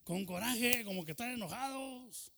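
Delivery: put-on rally the kids to push like they're mad; lightly voiced uncle with a mock angry edge; uncle urging. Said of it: "decía él".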